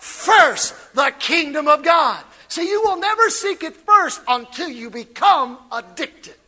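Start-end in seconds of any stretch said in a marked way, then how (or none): none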